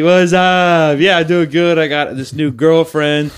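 high, loud casual dude; bro voice. Retold or imitated. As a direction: brag like a carefree bro. Imitating Robert's update.

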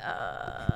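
low, soft speechless breath; speechless breathy uh. Retold or imitated. Girl caught in dirty socks, a soft stunned uh.

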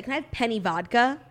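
"penny vodka" in flat-out American sounds; all-American "penny vodka"; Anglicized pronunciation. Shown as a demonstration. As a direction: say penne as "penny", dropping "alla". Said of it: "would also never say".

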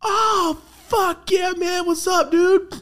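very high, loud, overjoyed yelling; big man yelling in joy; yelling excitedly. Retold or imitated. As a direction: shout it like a fan spotting his idol. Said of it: "He starts yelling my name like".

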